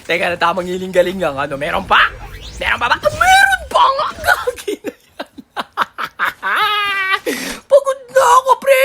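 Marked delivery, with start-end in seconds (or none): 3.0-8.8 high, laughing-crying yell; worn-out gamer yelling; exasperated shout. Real, not acted. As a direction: shout in mock despair. More enemies, exhausted gamer.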